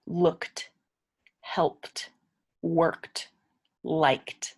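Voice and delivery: "Looked, helped, worked, liked" each ending on a crisp t; t sound endings. Shown as a demonstration. Teacher to English learners, modelling the t ending. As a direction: snap the final t of every verb. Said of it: "sometimes it makes a T sound".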